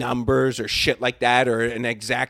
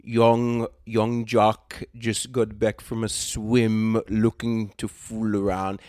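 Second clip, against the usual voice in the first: slow, drawn-out mock Indian accent; badly faked Indian English, words stretched; attempted Indian accent; 3 semitones lower, slower than usual. Indian caller leaving a chat-line ad, badly imitated Indian accent. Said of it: "if I could do the Indian".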